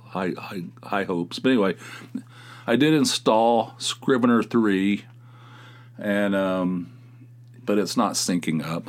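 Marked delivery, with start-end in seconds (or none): none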